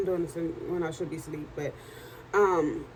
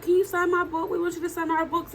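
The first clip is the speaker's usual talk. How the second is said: eager fans asking; 9 semitones higher than usual. Retold or imitated. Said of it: "I was like".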